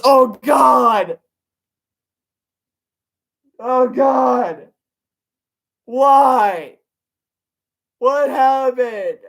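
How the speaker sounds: high, loud cries of disbelief; shocked shout. Real, not acted. Reader horrified the babies died, shouting.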